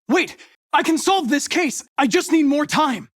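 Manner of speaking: calling out in desperation; desperate plea. Acted. Pleading not to be shut down.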